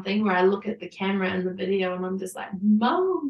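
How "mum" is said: higher, teary call to mum; tearful call. Retold or imitated. Looking at camera, calling to mum through tears. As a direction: cry out to her mother.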